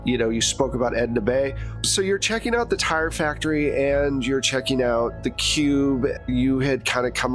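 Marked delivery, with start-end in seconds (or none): none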